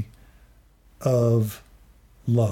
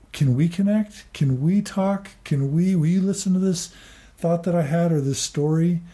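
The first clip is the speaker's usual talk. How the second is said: lightly voiced wife asking, pitched higher; imitating his wife; 5 semitones higher than usual. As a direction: mimic her asking him to listen, a bit higher. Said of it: "reach out to me and say".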